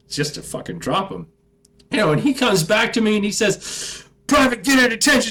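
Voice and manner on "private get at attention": fast, high, loud sergeant bark; drill sergeant yell. Retold or imitated. Drill sergeant ordering the private to look at him. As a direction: snap the order at the recruit.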